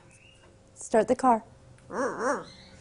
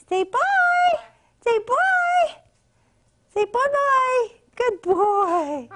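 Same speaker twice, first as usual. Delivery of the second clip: high sing-song coaxing for the bird; 6 semitones higher, much slower than usual. Real, not acted. Owner coaxing her parrot to say bye, high sing-song.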